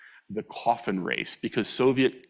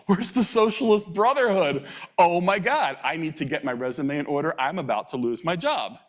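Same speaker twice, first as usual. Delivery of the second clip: mock panic; 7 semitones higher than usual.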